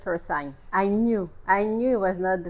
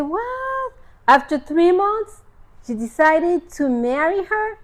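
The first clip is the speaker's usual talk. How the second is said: high squeak, then louder disbelieving question; scandalized amazement, pitched up; incredulous; 8 semitones higher, 5 dB louder than usual. Retold or imitated. Shocked at three-month courtship.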